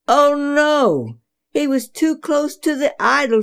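high and loud, a sudden gasp of alarm. A narrator's reading. Narrator crying out as the stick hits the idols, alarmed. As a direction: cry out as disaster strikes.

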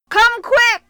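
loud urgent call. Acted. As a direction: shout for help urgently. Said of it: "he called".

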